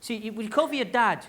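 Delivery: usual speaking voice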